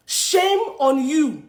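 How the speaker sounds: drawn-out shame on you; slow, deliberate reproach; slow scolding. Acted. Scolding the newspaper.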